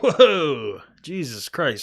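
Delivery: loud, high startled whoa; blurted surprise at a friend's confession; shocked whoa. Retold or imitated. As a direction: recoil at the news, half-laughing. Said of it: "I was like".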